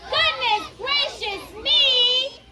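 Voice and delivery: slow, high fussy hen. Shown as a demonstration. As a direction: stretch it out for the kids to copy. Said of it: "Say it again".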